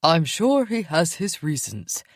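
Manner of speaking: slow, measured reassurance; calm the others down; a hint of an older wolf calming the pack; older male wolf. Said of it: "another older male said".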